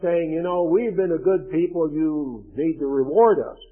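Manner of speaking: earnest, pleading scripture prayer; reading Daniel's plea for mercy. Acted. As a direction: implore God like Daniel. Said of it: "He says".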